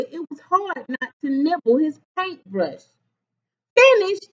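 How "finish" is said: high, proud call; raised, excited announcement. A narrator's reading. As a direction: call out that the work is done. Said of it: "he called".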